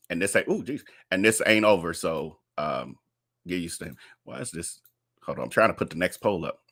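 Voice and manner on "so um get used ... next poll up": low, soft talking to yourself; distracted mutter. Real, not acted. Host struggles to put the next poll up, distracted low mutter. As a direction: drop right down while busy with a task.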